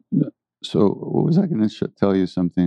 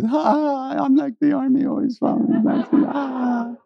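high, mocking singsong imitation of the guru; guru's high teasing echo; mocking guru laugh. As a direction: mimic him laughing it off, pitched way up. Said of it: "Maharaj goes".

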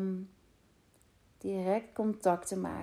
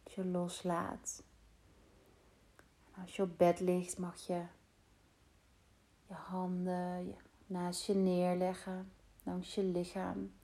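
guided meditation. Acted. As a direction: guide them calmly into rest. Leads a meditation. (rustige meditatiestem)

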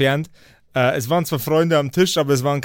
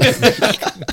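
loud amused laugh; burst of laughter; 7 semitones higher, 4 dB louder than usual. Real, not acted. Co-host laughs at the self-written comment, loud laugh. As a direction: let out a real laugh.

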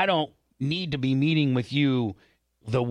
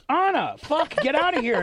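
high yelled outburst; 11 semitones higher than usual. Retold or imitated. Retold yelling at coworker.

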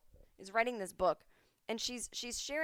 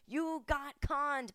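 ordinary talk, nothing marked

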